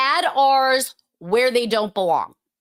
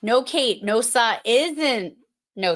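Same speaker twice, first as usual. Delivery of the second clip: usual speaking voice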